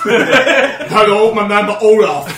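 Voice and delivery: loud, slow put-on masseur voice, laughing; mock masseur. Friends joking about a massage parlour, loud hammed-up masseur. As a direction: ham up a foreign masseur.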